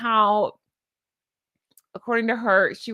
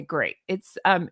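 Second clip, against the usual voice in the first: usual speaking voice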